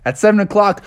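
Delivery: plain reading voice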